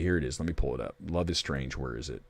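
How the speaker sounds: low, soft mumble to self; half under the breath; muttering while searching. Real, not acted. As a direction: trail into a quiet self-directed mumble while hunting. Searching on screen while talking.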